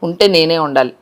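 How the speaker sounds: low, grudging jealous voice; jealous cat. Acted. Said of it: "చూడు ఎంత jealous గా ఉందో".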